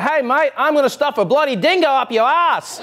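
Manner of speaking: put-on cheery Australian accent over an angry threat; upbeat Australian accent. Host imitating an angry Aussie in a cheerful Australian accent. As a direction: say a furious threat in a bright, sunny Australian lilt. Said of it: "their accent always sounds upbeat".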